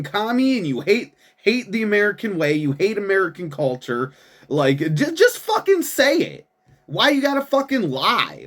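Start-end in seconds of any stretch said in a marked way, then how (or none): 5.1-8.4 much higher, strained angry yell; exasperated outburst. Real, not acted. Yelling at the mayor to just admit it, angry outburst. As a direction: blow up at him in frustration.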